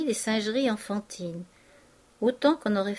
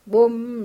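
a louder boom of a big drum; mock drum boom; 6 dB louder than usual. A narrator's reading. Vautrin mimicking the fairground band, mock drum boom. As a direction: thump it out like a bass drum.

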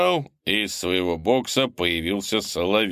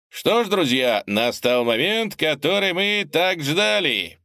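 announcer voice; 8 semitones higher than usual. Acted. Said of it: "поприветствовал он зрителей".

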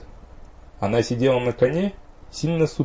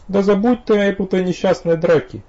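higher, louder outburst; breaking into a shout; 10 semitones higher, 4 dB louder than usual. A narrator's reading. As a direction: snap into a near shout. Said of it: "сорвалась на крик вампиресса".

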